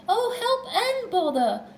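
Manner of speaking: high, wailing complaint; high, exasperated wail; dismayed. A narrator's reading. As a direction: cry out helplessly at being stuck. Pooh stuck, calling for help.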